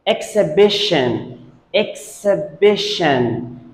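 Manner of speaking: EXHIBITION said ek-suh-BISH-un, silent H; correct pronunciation. Shown as a demonstration. Teacher models the word for students, correct pronunciation. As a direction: say exhibition carefully with "eks" and weak schwas. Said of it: "There are two schwa sounds here".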